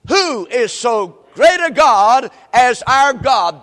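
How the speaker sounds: loud, very slow pulpit cry; scripture proclamation. Real, not acted. Preacher proclaims God's greatness to the congregation, loud and slow. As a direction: draw out each word at full force. Leads congregation in repeating verse.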